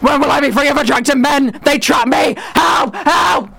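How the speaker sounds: hammed-up anguished wail, high-pitched; passionate outburst. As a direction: mock-desperate cries for help. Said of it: "I got a bit passionate there".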